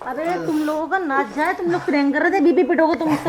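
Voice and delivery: loud telling-off after a prank; indignant scolding. Real, not acted. Mother scolding sons after prank reveal. (गुस्से में ज़ोर से डाँटते हुए)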